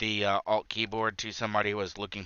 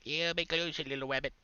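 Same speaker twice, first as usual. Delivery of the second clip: higher, softer coaxing voice; coaxing sing-song; 6 semitones higher, 5 dB quieter, much faster than usual. Pretend. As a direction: lure a pet closer gently. Coaxing the rabbit closer.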